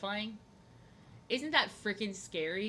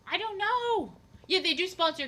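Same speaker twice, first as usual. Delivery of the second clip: I don't know in a much higher, squeaky put-on voice; high silly voice; 11 semitones higher, 8 dB louder than usual. Mulling what to draw, then a high cartoonish put-on voice. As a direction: pitch it way up like a cartoon character. Playful put-on voice while drawing.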